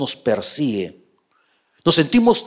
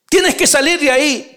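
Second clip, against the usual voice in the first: mucho más agudo e insistente; ruégale que salga; insistiendo; 10 semitones higher, 3 dB louder than usual. Acted. Insisten desde fuera. (insistencia aguda y fuerte)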